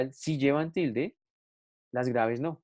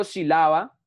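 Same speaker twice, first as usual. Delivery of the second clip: "sílaba" wrongly stressed on LA, si-LÁ-ba; misplaced middle stress; 5 dB louder, slower than usual. Shown as a demonstration. Teacher shows what one doesn't say, "sílaba" stressed on LA. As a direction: lean on the middle LA of "sílaba", rising. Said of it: "yo no digo sílaba".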